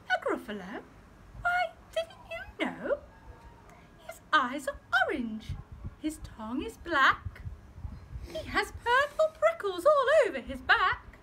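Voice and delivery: high mouse voice spinning a scary tale; scheming mouse. Acted. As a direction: spook the fox with a high mouse voice. Mouse describes Gruffalo.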